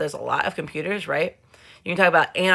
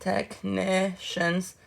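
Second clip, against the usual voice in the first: "technicians" drawn out syllable by syllable; slow sounding-out; 2 semitones higher, 4 dB quieter, much slower than usual. Real, not acted. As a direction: say "technicians" very slowly, as if spelling it. Sounding out a spelling while writing.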